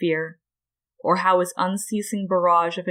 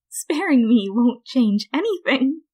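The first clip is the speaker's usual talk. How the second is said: high, smug, smirking voice; smirking Flowey; 6 semitones higher, 4 dB louder than usual. A narrator's reading. Defeated flower smirks at the human, high and smug. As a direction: let the smirk come through. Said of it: "hear the smirk in that voice".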